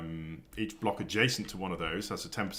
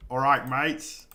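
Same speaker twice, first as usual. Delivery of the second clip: hammed-up Aussie accent that slips, higher and louder; a loud, over-the-top stab at an Australian accent; failed Australian accent; 7 semitones higher, 12 dB louder, slower than usual. Streamer greeting Australian viewers, mock Australian accent. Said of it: "That's not Australian, is it?".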